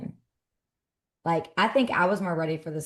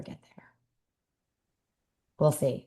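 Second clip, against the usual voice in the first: whisper. Real, not acted. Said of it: "unvoiced whisper".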